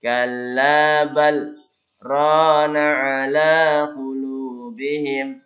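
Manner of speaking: "Kalla bal" slow with a brief stop after "bal"; saktah pause. Shown as a demonstration. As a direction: hold a short breathless pause after "bal", then "rana". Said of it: "di sini ada saktah".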